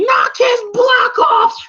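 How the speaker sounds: high, loud, slow shouted order; Mickey yelling. Retold or imitated. Trainer Mickey screaming at Rocky, high, loud and slow. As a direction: bellow it like a frantic cornerman. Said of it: "Mickey going".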